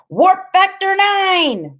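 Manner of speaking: high, slow, ringing battle cry; shouting. A narrator's reading. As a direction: shout it like a starship captain. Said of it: "Freak is shouting".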